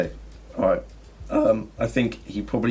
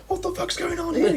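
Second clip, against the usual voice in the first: much higher, indignant yell; angry outburst. Pretend. Crew member angry at glitching contract, high indignant yell. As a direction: play the baffled, annoyed gambler. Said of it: "so that they go".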